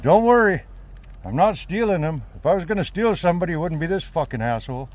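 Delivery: high, loud call to a stranger; calling across the road. Retold or imitated.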